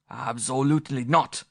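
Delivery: ABSOLUTELY NOT said high and firm; flat firm denial, German accent; emphatic. A narrator's reading. Director denying smoking, emphatic German accent. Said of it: "he said emphatically".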